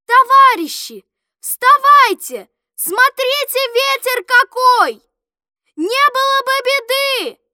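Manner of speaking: high, loud wake-up cry; urgent high-pitched warning call; alarmed shout. A narrator's reading. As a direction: shout to rouse sleeping comrades. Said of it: "отец вскочил и закричал".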